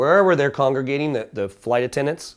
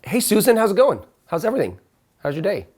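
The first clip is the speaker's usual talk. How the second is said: bright friendly hello, raised; cheerful greeting; 6 semitones higher than usual. Retold or imitated. Guest greeting the hotel clerk Susan cheerfully. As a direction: greet her like an old friend.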